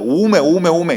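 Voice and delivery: loud Jeolla-style startled 오메; 전라도 사투리로 크게 놀란 오메; Jeolla dialect exclamation. Acted. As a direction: cry 오메 like a startled Jeolla grandmother. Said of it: "전라도 말로 하면".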